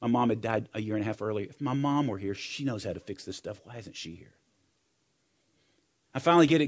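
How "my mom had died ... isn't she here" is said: soft, sinking recollection of grief; retold tearful thoughts. Son missing his late mom at graduation, subdued retold grief. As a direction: let the retold lament fade almost to nothing. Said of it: "here's why I started crying".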